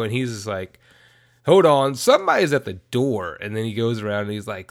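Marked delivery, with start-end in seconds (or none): 1.4-3.4 loud, casual hold on; mock call out. Weatherman on the phone with the DJ, loud mock call. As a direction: call out to her, half distracted.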